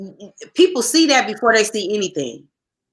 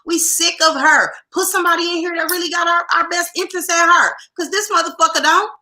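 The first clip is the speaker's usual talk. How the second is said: heated and pitched higher, building up; riled-up higher-pitched tirade; fired up; 7 semitones higher than usual. Real, not acted. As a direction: rant like you're fed up with the council. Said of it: "you got spicy now".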